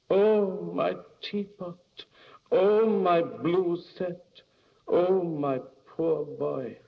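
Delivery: high, drawn-out wailing; mother's lament. A narrator's reading. Mother laments broken china, imagined.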